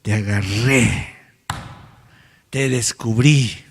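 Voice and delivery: slow playful gotcha; caught you. Shown as a demonstration. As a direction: draw it out like a playful catch. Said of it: "dile a tu vecino".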